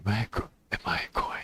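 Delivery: breathless stoned whisper. Acted. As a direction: whisper it in awe.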